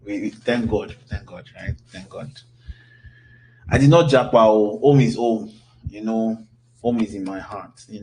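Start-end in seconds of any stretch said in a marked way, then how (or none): none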